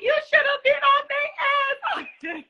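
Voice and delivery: high-pitched mock mama cheering; imitating mom. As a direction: hype it up like a proud mother. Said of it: "I was like".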